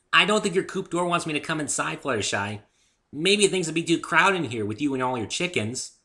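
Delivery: high, loud cheerful pony; bouncy chatter while stuck; chipper Pinkie voice. A narrator's reading.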